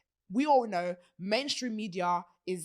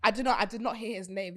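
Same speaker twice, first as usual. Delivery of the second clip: usual speaking voice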